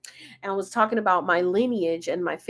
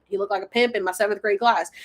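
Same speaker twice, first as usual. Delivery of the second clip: ordinary delivery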